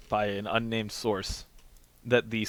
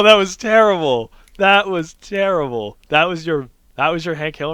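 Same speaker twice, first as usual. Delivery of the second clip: high, loud and slow; hammed-up horror at a bad impression; mock outrage; 6 semitones higher, 9 dB louder, slower than usual. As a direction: wail it in fake disgust.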